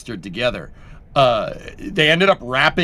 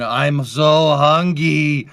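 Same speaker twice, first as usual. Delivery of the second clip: very slow, drawn-out hungry whine; Homer Simpson; 5 dB louder, much slower than usual. Retold or imitated. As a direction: moan it out slowly like Homer. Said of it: "He posted the Homer Simpson".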